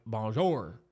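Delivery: mock American accent on a foreign greeting; Brad Pitt. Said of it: "You gotta sound like".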